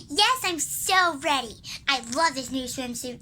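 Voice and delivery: normal talking voice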